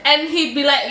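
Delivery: ordinary talk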